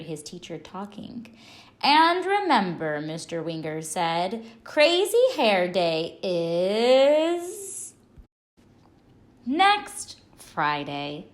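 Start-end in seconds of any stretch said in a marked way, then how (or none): 1.8-3.0 high, loud teacher announcement; teacher's call. Acted. Teacher calling to his class, loud and high. 4.6-11.3 slow, loud teacher voice; drawn-out announcement. Acted. Teacher drawing out the date to his pupils, slow and loud.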